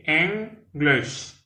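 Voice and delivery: English said /enɡleʃ/, first syllable EN stressed, high and clear; two clear syllables EN-glish; model pronunciation. Shown as a demonstration. Teacher modelling English for French learners.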